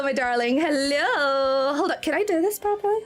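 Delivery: normal talking voice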